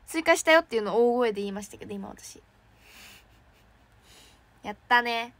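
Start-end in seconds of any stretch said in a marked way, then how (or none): none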